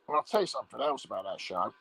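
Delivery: ordinary delivery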